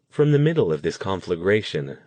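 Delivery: ordinary delivery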